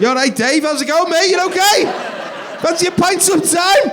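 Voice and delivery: high, loud mock-friendly shout; over-loud, high hail across the carriage; hollered matey greeting. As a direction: call out to a mate at full pitch.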